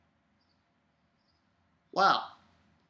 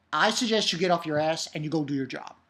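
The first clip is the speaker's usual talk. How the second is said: ordinary talk, nothing marked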